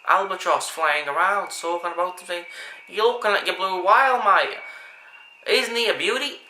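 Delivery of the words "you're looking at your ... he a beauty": loud, hammed-up Australian accent, openly amateur; booming Aussie wildlife-presenter voice, louder; Steve Irwin Australian accent. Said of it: "not the best Australian accent that I can do".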